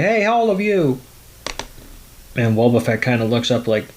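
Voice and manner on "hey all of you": strange voice calling. Retold or imitated. Old man calls to all the Pokemon, mock hailing. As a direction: call out to the group in a raised pitch. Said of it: "a strange voice say".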